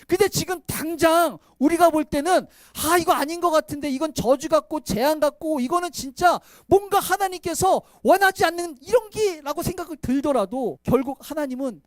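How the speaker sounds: loud, driving preacher voice; fervent pulpit delivery; preaching at full force. Acted. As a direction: preach it out to the congregation.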